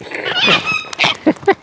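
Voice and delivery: very high-pitched delighted laugh; squeaky high giggle. Real, not acted. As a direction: giggle way up in the head voice. Laughing at the birds.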